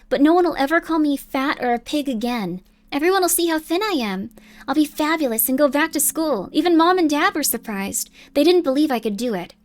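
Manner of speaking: put-on lightly girlish, lilting diary read, proud and relieved; earnest young girl. Girl tells diary of weight loss, earnest lilting voice. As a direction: confide it like a triumphant schoolgirl. Acts out girl's diary entry.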